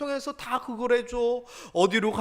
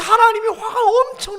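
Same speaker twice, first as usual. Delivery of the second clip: high, loud, crying-out emphasis; emphatic high cry; 10 semitones higher, 9 dB louder than usual. Real, not acted. Preacher stressing God's anger. (높고 크게 외치듯 강조)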